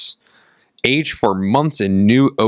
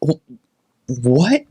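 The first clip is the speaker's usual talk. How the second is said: halting, baffled question with higher pitch; retold confusion; 5 semitones higher than usual. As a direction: act out stammering bewilderment. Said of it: "this look of confusion".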